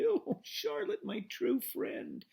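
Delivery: high, slow, moaning grief. A narrator's reading. Wilbur to dying Charlotte, a slow high moan. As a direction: wail it through sorrow. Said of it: "he moaned".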